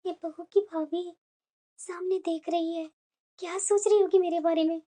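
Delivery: low, soft, ashamed voice; hurt inner thoughts. Acted. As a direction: murmur your embarrassment to yourself. Humiliated mother-in-law thinks to herself. (शर्मिंदा होकर धीमे से मन ही मन)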